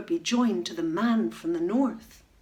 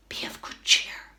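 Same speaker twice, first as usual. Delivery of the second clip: whispered. Acted. Old badger whispers comfort to the captive swans. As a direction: breathe it to them secretly. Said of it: "whispered a grey old badger".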